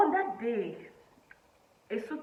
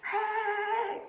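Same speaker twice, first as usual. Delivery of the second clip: a high, held, loud exclamation; high exclamation hey; 9 dB louder than usual. Real, not acted. Exclaiming at her mom's horror, high and loud. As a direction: cry out in amused disbelief.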